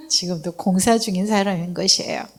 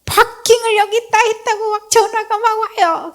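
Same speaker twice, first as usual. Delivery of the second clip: high, raised mock angry woman; angry woman caller; 13 semitones higher than usual. Woman scolding over parking, mock angry high voice. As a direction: imitate the irate caller, pitched way up.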